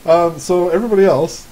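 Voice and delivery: usual speaking voice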